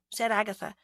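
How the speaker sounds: plain reading voice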